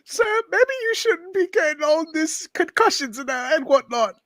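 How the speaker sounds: high-pitched fretful voice; high worried sidekick. Acted. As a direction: squeak it out like a nervous sidekick. Voicing sidekick warning the hero.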